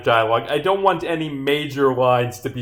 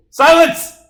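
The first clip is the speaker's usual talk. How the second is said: very high, loud outburst; commanding shriek; 11 dB louder than usual. Acted. Witness silencing detective, imperious shriek. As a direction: shriek it to cut them off.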